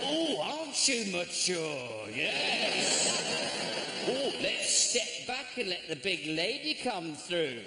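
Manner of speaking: slowed mock admiration for the daughter; sarcastic heckler put-down. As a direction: talk down to her like a heckler. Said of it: "I didn't say that".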